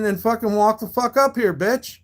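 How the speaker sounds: normal talking voice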